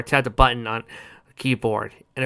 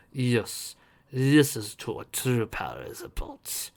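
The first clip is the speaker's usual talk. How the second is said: sinister man voice; 4 dB quieter, slower than usual. Acted. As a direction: gloat quietly. Captor voice.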